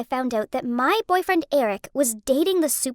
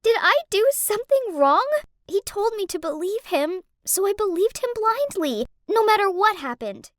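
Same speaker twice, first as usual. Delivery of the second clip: tearful; 6 semitones higher than usual. Acted. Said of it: "burst into tears upon hearing that".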